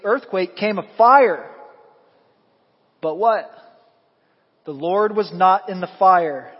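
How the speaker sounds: loud, rising preacher's build; preaching the storm at full force; fervent preaching. Acted. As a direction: drive the scripture home with force.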